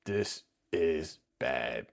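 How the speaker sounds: low, slow, heavy disapproval; slow low groan of disapproval; dismayed verdict. Retold or imitated. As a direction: drag it out low, shaking your head. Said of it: "I was like".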